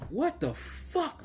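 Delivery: high, soft and slow, What the fuck stretched out; retelling his disbelief at the match, drawn out and high; stunned reaction. Retold or imitated. Fan retelling watching Cardiff, stunned and slow. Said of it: "And I'm like".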